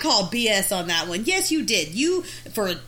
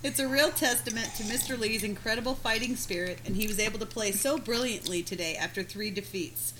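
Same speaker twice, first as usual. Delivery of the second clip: reading aloud; 2 semitones lower, 8 dB quieter than usual. Acted. Reads article aloud.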